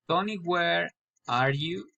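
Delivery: "are you" climbs at the end; name first, then lift "are you"; rising intonation. Shown as a demonstration. Learner practising direct address, rising on "are you". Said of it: "raising intonation".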